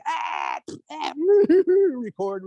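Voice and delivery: high hammed-up bawling; exaggerated little-kid sobbing noise; mock crying wail. Boy answers his mother about school, mock bawling.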